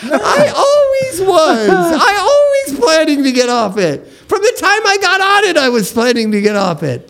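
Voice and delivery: high laughing insistence; high, laughing denial to friend; laughing protest. Real, not acted. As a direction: protest while cracking up.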